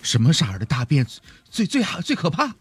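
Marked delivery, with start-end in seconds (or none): 1.5-2.5 excited. Real, not acted. Gleeful gross-out question.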